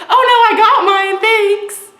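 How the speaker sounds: high, very loud comic shout; screamed reply. Retold or imitated. Screaming at the cashier that she has her phone, high and loud. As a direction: yell it out high, cheerfully oblivious. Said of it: "screamed at him that I had my phone".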